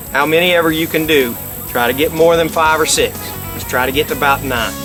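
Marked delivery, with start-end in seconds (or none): none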